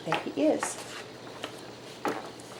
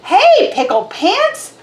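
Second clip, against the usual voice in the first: high, slow holler across the yard; 11 semitones higher, 4 dB louder, slower than usual. A narrator's reading. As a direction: call out loud and high like a mother. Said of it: "hollered Mama".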